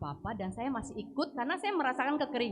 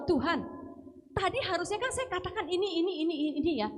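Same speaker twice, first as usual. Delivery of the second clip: indignant complaint to God; 8 semitones higher than usual. Retold or imitated. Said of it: "saya bilang".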